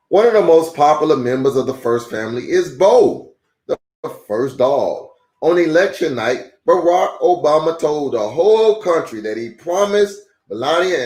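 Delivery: steady, clear read-aloud voice; reading to children. A narrator's reading. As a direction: read it like a picture book for kids. Reads a children's book aloud.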